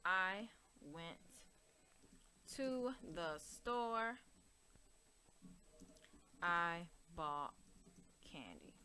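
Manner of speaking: slow, trailing read-out; slow dictation. Shown as a demonstration. Teacher reading the original two sentences slowly. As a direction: read it out word by word. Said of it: "That's what it said at first".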